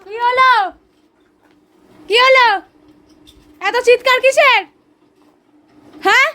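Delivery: high, loud scolding shouts; loud, high telling-off shout; calling out loud. Real, not acted. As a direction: yell at the barking dog to stop. Yelling at noisy dog.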